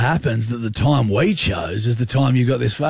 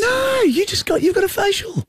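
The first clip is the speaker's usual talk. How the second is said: mock innocent squeak; high, far-off squeaky dodge. Dodging invite, squeaky voice.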